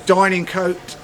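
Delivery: normal talking voice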